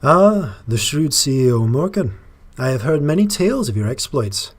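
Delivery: a lightly put-on Scottish lilt as Lady Deirdre; a half-right Scottish voice for the leader; Scottish accent. Player voicing Lady Deirdre's greeting, in a light Scottish accent. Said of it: "it's not really quite the right Scottish accent".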